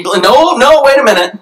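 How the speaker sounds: high, loud cut-off; loud interruption. Retold or imitated. Spouse cutting off a dredged-up grudge, loud. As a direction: stop them sharply.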